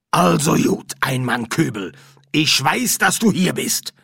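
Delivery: brisk medium addressing a ghost, loudly; summoning medium. Acted. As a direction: call the spirit to account.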